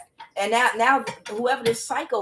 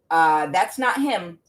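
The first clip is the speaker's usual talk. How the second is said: normal talking voice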